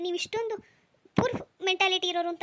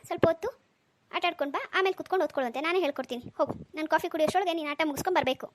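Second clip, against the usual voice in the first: usual speaking voice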